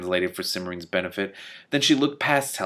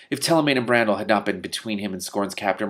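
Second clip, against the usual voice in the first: ordinary delivery, nothing marked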